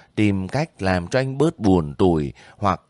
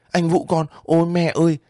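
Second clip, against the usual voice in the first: put-on high child's exclamation; sudden high-pitched burst of emotion; startled outcry; 9 semitones higher, faster than usual. A narrator's reading. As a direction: cry out at astonishing news. Child hears her brother is home.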